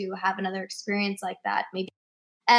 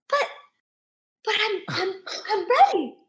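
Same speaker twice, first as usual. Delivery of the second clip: high, halting mock-crushed stammer; stammering protest; 11 semitones higher than usual. Actress protesting being turned away from an audition, high stammering protest. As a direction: sputter a helpless protest, much higher.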